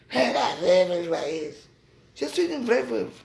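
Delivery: hammed-up sneer at her rival's voice; mocking rombière voice. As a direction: parody of a stuck-up old lady, drawn out. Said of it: "avec sa voix de rombière".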